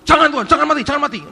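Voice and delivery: high-pitched impulsive pleading; hammed-up eager objection; energetic, rash protest. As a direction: blurt it out high, imitating an impulsive disciple. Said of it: "Energik tapi ngawur".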